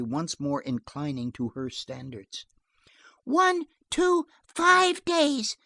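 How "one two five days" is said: put-on high, loud child's counting; counting little girl. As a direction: count out firmly like a small child. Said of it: "counted Joan".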